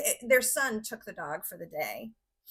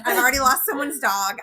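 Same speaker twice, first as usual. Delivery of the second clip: laughing despair; 4 semitones higher, 10 dB louder than usual. Retold or imitated. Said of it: "I'm not made for this".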